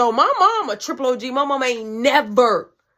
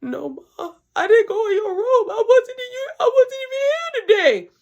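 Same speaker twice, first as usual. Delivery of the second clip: put-on high, whining kid's voice, louder as it goes; whiny denial; 13 semitones higher, 5 dB louder than usual. Son lying to his mom about the weed box, high whiny plea. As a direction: plead your innocence like a scared kid. Son denying, whimpering, retold.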